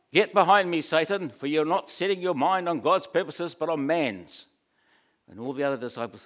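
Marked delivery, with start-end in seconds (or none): 0.1-4.5 loud, higher, stern rebuke. Retold or imitated. Jesus rebuking Peter before the disciples, loudly. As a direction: command him back, forcefully. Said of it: "I believe he would have said it quite loudly".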